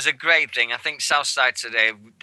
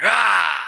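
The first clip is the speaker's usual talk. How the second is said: loud, higher "rah" roar; sudden roar in imitation; mock monster roar; 10 semitones higher, 6 dB louder than usual. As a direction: imitate a roaring charge at the crowd. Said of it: "he's going round and he's going".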